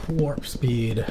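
quick whisper; fast whispering asmr. Acted.

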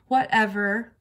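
loud, dismissive whatever; huffy kid's comeback; sulky dismissal. A narrator's reading. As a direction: brush off the correction. Sulky reply to being corrected.

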